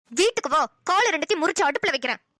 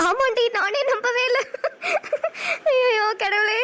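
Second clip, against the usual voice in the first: happy tears; 4 semitones higher, slower than usual. Acted. Overwhelmed by twins news.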